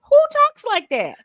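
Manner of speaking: high, loud shocked squeal; shrieked disbelief. Real, not acted. Stunned by the text, shrieking disbelief.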